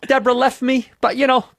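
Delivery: loud Boston shrug over a breakup; Bill Burr. Acted. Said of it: "I'm doing my Burr".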